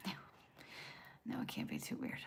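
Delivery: low, soft whisper. Real, not acted. Streamer whispering to a shy viewer. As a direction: breathe it out reassuringly.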